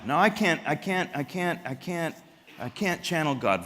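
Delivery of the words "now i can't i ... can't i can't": ordinary talk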